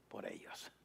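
whispered, breathy end to the phrase. Real, not acted. Preacher urging the congregation to serve others, whispered. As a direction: drop into a whisper on the last words. Sermon point about serving others.

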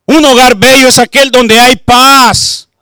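loud, fervent sermon delivery; preaching at full force. Acted. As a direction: preach it out to the congregation.

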